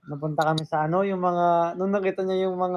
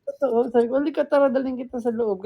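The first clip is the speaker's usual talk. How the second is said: excited retold quote; 7 semitones higher, 3 dB louder than usual. Retelling her own excited reply.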